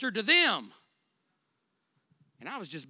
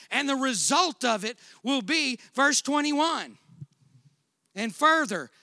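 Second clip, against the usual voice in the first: preaching; 7 semitones higher, 6 dB louder than usual. Acted. Preaching at full force.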